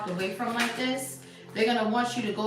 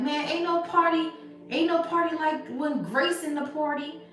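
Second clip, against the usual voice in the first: mock hype party shout, pitched up; hype party guy; 6 semitones higher than usual. To viewers, playing a hyped partygoer, higher-pitched brag. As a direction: hype up the room like the life of the party.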